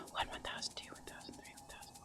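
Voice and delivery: whispering the seconds while curling hair; whispered counting. Shown as a demonstration. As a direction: whisper the count under your breath. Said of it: "I normally whisper count to myself".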